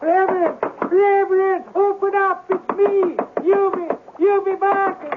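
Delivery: bang on the door and yell for him; frantic shout to be let in. Acted. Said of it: "There's no need to shout".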